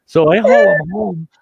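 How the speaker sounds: ordinary talk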